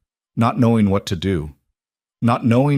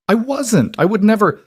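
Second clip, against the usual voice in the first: high, loud pleading protest; desperate denial; 13 semitones higher, 4 dB louder than usual. Acted. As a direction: plead your innocence, voice rising. Accused wife denies desperately.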